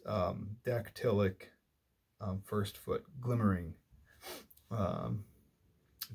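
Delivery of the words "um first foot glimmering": ordinary talk, nothing marked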